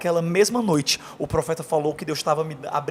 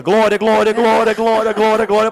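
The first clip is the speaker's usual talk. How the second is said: loud, higher, chanted glória glória; pentecostal shouting praise; 4 semitones higher, 7 dB louder than usual. Retold or imitated. Man retelling his prayer night, shouting praise loudly. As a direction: belt it like a fervent pentecostal. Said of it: "igual aqueles pentecostal que fica".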